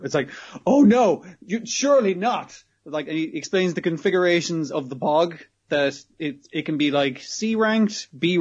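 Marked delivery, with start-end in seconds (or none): 0.6-2.6 high, loud, theatrical disbelief; mock shock. Host reacting to his aide's whisper, mock shock. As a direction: play up the host's feigned shock.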